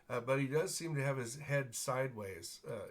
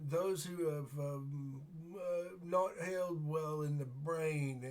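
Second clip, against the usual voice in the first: put-on rambling, higher-pitched old man voice; dotty old man; 5 semitones higher than usual. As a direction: ramble haltingly like a muddled old beggar. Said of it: "he says".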